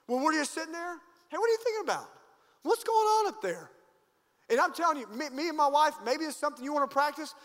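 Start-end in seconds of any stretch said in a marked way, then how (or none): 1.3-3.7 much higher, teasing check-in; playful high question. Retold or imitated. Husband asking his wife what she is thinking, high and playful. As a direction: sing it up to your wife, curious.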